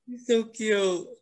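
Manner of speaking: high, drawn-out coo over a pet; melting over a cute puppy; adoring coo. Real, not acted. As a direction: gush slowly in a high, cutesy voice. Fawning over Henry the pet.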